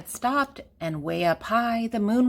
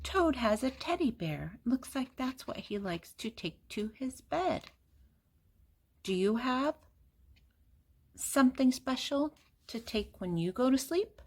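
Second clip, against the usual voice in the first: soft aside; 5 semitones higher, 6 dB quieter than usual. Real, not acted. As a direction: lean in and ask the little ones. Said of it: "aside to listening children".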